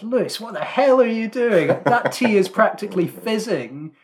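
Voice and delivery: high, loud imitation of a scandalised dad; mock outraged dad. Dad walks in on son making tea, mock outrage. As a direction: tell him off in hammed-up disbelief.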